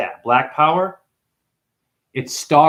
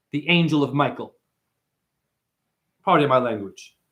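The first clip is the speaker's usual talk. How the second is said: normal talking voice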